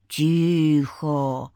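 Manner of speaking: low, drawn-out command for silence; rumbling bass. A narrator's reading. Spirit silencing the quarrelling men, rumbling bass. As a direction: drop deep and silence everyone. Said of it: "Рокочущий бас".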